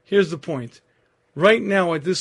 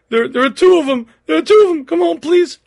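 panicked pleading; 11 semitones higher, 10 dB louder than usual. Retold or imitated. Terrified wife begging on phone.

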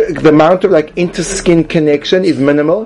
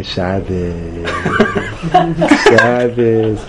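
ingratiating greeting; 4 semitones lower, much slower than usual. Shown as a demonstration. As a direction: greet the host with a smarmy smile. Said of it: "an ingratiating smile".

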